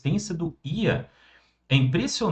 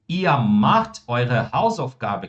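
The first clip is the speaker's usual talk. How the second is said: very slow, every German word laid out clearly; slow model sentence; 3 semitones lower, much slower than usual. Shown as a demonstration. Teacher modelling German for students, very slow and clear. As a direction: spell the sentence out slowly for the class. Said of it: "Quando eu digo".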